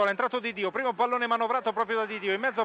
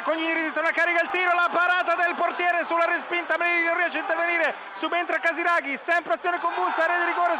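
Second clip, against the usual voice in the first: excited play-by-play; 8 semitones higher than usual. Acted. Live play-by-play rising.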